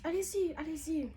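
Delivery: high, loud "allez-y", pleading to get it over; desperate go-ahead, high and loud; eager begging. Retold or imitated. Begging for the episiotomy.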